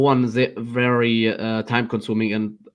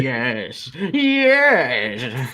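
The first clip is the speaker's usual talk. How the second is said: sinister gloating yes; villain voice. Pretend. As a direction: hiss of evil approval. Villain banter continues.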